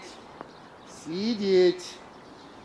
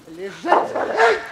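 окрик; 9 dB louder than usual. Real, not acted. Окрик на собаку.

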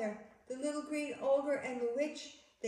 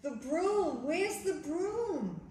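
higher, drawn-out cry for the broom; alarmed searching; 4 semitones higher, slower than usual. Acted. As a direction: act out a storybook worry, stretching the words. Acting out search for lost broom.

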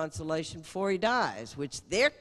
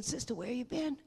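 higher, softer Cajun father; gently pitched-up plea; father looking the nun in the eyes, softer; imitating Lloyd; 8 semitones higher, 4 dB quieter than usual. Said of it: "he's saying".

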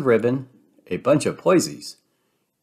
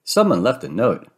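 ordinary delivery

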